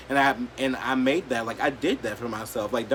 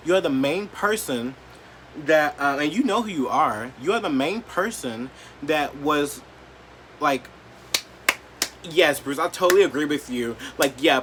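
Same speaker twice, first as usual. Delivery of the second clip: usual speaking voice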